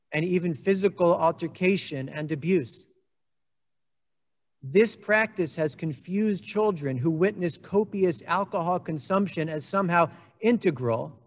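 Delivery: usual speaking voice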